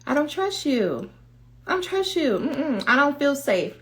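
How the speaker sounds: high, small voice of mistrust; distrustful inner voice. Acted. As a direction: refuse in a high little voice, unhurried. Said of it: "That voice is saying".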